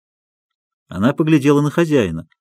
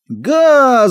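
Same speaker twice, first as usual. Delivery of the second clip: high loud shriek; clown's shriek; 10 semitones higher, 4 dB louder than usual. A narrator's reading. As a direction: shriek at the crowd. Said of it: "закричал хозяин". (визгливый выкрик)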